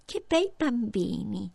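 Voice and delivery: slow, sugary witch voice; a lightly voiced witch cooing at the children; sly old witch. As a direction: lure the children in slowly.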